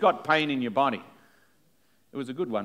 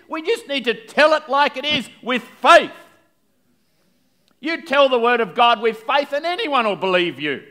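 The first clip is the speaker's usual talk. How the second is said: high, loud, driving sermon delivery; full-force preaching; 12 semitones higher, 8 dB louder than usual. Real, not acted. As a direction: preach it out boldly, pitched high.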